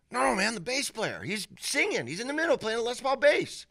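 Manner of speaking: very high-pitched, pressing insistence; imitating insistent buddy. Buddy correcting his doubting friend, imitated insistence. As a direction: play the buddy refusing to be doubted.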